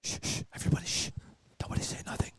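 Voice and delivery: hushed unvoiced whisper. Real, not acted. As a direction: whisper everyone to silence. Hushing the room before call.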